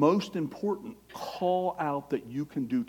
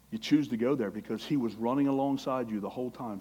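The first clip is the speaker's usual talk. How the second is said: usual speaking voice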